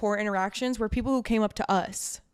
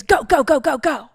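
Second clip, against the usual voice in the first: loud, higher, pushy shouting; retelling a stranger yelling at her in a bar; mock yell; 4 semitones higher, 10 dB louder than usual. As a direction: bark it out to shove someone aside. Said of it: "yelled in my face".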